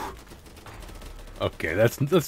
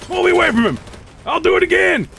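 frantic yelling; 12 semitones higher than usual. Real, not acted. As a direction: yell to be dragged away. Wounded, yelling at squad.

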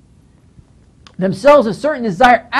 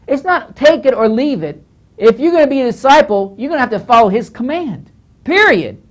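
ordinary talk, nothing marked

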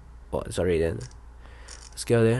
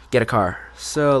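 ordinary talk

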